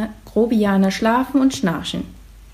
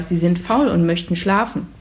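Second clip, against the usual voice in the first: plain reading voice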